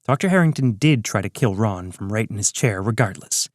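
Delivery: ordinary delivery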